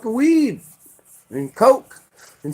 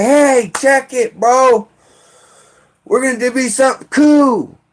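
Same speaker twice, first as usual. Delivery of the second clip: higher, louder mock buddy pitch; fake friendly; 6 semitones higher, 3 dB louder than usual. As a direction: lure them in with fake cheer.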